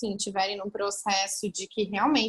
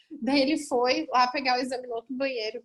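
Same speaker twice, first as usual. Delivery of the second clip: normal talking voice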